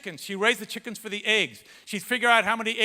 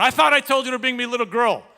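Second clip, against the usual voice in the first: loud, higher angry yell at her husband; retold yell; 5 semitones higher, 9 dB louder than usual. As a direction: bark it like a furious wife. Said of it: "yells these words at her husband".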